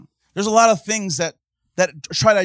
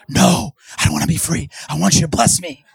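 strained, whiny creepy voice, rising louder; creepy mock voice; 3 semitones higher, 3 dB louder than usual. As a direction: play the greedy believer, creepy. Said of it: "It sounds creepy".

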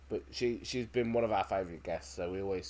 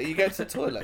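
high, loud mock questioning; incredulous question; 10 semitones higher, 7 dB louder than usual. Onlooker questions a player about the toilet, mock incredulous. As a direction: put on a high, surprised-sounding question.

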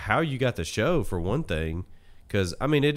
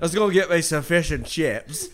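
mock British accent; 5 semitones higher than usual.

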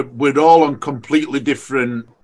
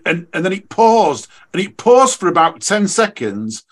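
normal talking voice

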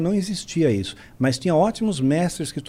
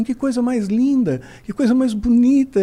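touched wife; 6 semitones higher than usual. Retold or imitated. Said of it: "meio que chorando".

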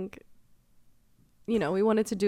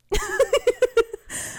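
high laugh; 6 dB louder than usual. Real, not acted. Laugh burst.

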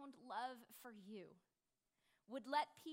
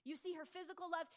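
ordinary delivery

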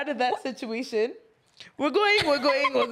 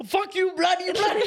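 a hint of an Indian accent, higher and laughing; Indian accent curse; 6 semitones higher than usual. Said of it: "She said".

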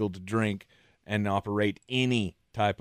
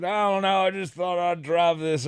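casual mock Southern accent; 6 semitones higher than usual. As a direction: play him as a Southerner. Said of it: "giving him a southern accent".

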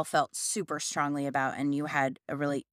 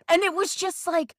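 mock angry crying; 13 semitones higher, 6 dB louder than usual. Employee recalls crying in front of her boss, mock angry crying. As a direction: keep the very high, loud put-on wail. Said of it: "cried because I'm so angry".

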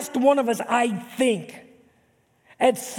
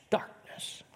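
whispered word; 13 dB quieter than usual. Acted. Preacher whispering the darkness over the land. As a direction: breathe darkness out as a soft whisper.

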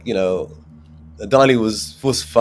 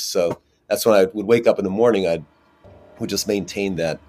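ordinary delivery

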